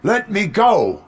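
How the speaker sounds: high, loud demand for release; desperate shout. Acted. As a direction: yell it while straining against restraints. Restrained cop demands release.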